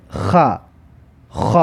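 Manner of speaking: "خ" rasped at the back as "kha"; kha with fatha. Shown as a demonstration. Teacher models kha with fatha for learners, "خ" as "kha". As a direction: say "خ" as a scraped "kha".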